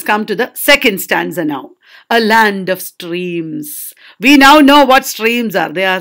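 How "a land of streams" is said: low, slow verse reading; poem recitation. Acted. Teacher opens the second stanza, slow recitation. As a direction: read it slowly, lingering on each word.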